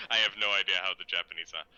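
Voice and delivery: ordinary talk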